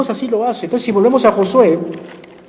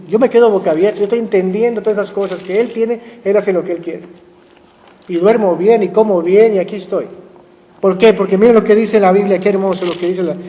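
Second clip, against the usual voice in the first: ordinary talk, nothing marked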